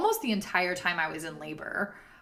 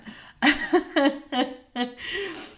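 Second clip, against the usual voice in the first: high laugh; 8 semitones higher, 4 dB louder than usual. Real, not acted. Laughing at the memory.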